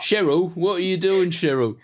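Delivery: put-on high, loud Aussie soap accent; Neighbours-style Aussie accent. Calling to Cheryl in a Neighbours-style Aussie accent. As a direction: pitch up a broad Neighbours Aussie voice. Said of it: "the neighbor's accent".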